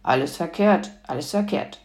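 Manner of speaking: lightly voiced grumbling grandmother; a mild, dismissive grumble. A narrator's reading. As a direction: grumble it under a touch of disapproval. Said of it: "brummte die Großmutter".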